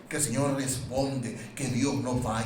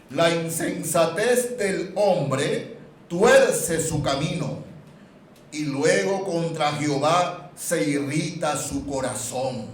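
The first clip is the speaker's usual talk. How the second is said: slow, louder reading of the verse; solemn scripture reading; 3 semitones higher, 7 dB louder, slower than usual. Acted. Preacher reading Proverbs to the church, slow and solemn. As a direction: read each word of the verse with weight.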